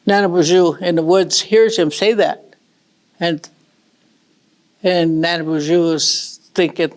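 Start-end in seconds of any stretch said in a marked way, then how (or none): none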